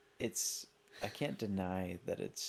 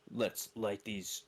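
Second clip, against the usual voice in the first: deep voice impression; slower than usual. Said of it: "He's got the super deep voice".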